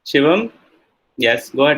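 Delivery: ordinary delivery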